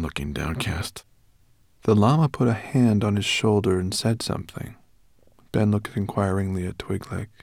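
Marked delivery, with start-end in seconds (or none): none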